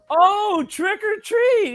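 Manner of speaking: very high, stretched-out mock delight; delighted old lady. Old lady finally understands the boy, delighted.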